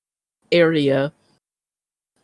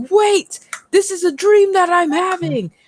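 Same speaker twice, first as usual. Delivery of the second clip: dream realization; 5 dB louder than usual. Retold or imitated. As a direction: shout it high as you catch yourself mid-dream.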